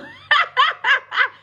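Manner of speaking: loud high burst of laughter; delighted high cackle. Real, not acted. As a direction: let out a big squealing laugh. Laughing about the filter.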